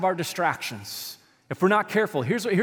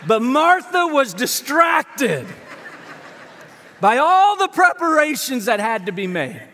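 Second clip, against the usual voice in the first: slow, loud, laughing through the words; preacher laughing at his own chaos, loud; laughing shout; 7 semitones higher, 6 dB louder, slower than usual. Real, not acted. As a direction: shout it while cracking up.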